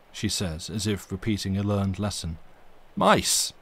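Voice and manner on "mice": high, loud scornful outburst; exclaimed. A narrator's reading. Narrator scoffing at the maid's excuse, loud exclamation. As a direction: spit out the word in disbelief. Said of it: "I exclaimed".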